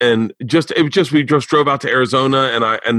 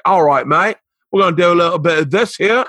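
put-on broad, higher-pitched British accent; rich British accent; 5 semitones higher than usual. Comic voicing a Japanese guy as a Brit, rich British accent. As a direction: lay on a thick British "mate" voice. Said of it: "doing a very rich British accent".

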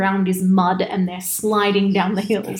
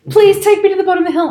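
much higher, louder and fast, pleading; imitated begging; 9 semitones higher, 5 dB louder, much faster than usual. Bride begging to be driven down the hill, loud and fast. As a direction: rush the plea out high and loud. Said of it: "and is like".

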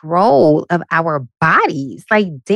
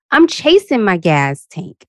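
ordinary talk, nothing marked

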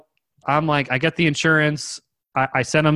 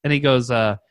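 ordinary delivery

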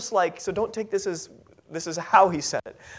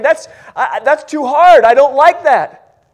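louder, higher mock complaint, a touch whiny; voicing reluctant disciples; 5 semitones higher, 11 dB louder than usual. Disciples grumble at Jesus's teaching, mock complaint. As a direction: play a grumbling follower who finds it too hard.

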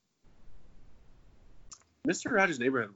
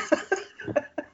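breathy laugh. Real, not acted. Laughs after meows.

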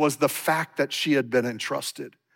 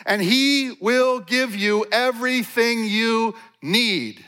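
raised, earnest Bible reading; scripture reading; 8 semitones higher than usual. Acted. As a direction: read the verse with fervor.

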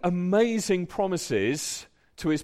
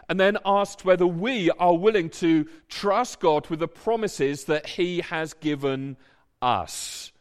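usual speaking voice

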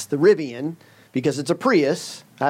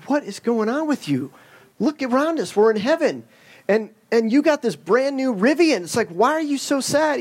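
higher, bewildered questioning; incredulous Joe; 8 semitones higher than usual. Retold or imitated. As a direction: ask in disbelief that anyone could be sad in heaven. Joe asks the weeping man.

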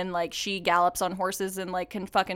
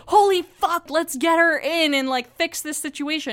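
high, loud mock alarm, easing at the end; imagined urgency; 6 semitones higher, 3 dB louder than usual. Imagined ER staff rushing to a hurt patient, urgent alarm. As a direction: act out staff leaping into action.

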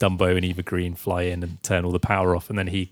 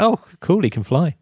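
mock casual kids; 8 semitones higher than usual. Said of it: "They're like".

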